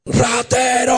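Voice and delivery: high, long drawn-out mock shout; Tarzan-style yell. Bystander calling out a backpack thief, mock Tarzan yell. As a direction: stretch it into a high Tarzan call. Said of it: "yo grité, pues hermano, como Tarzán".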